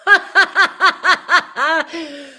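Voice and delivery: loud high burst of laughter. Real, not acted. Reader laughing hard at the card drawn. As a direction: let a big surprised laugh out. Card reading surprises her.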